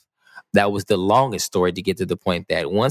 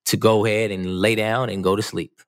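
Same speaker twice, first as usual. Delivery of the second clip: ordinary talk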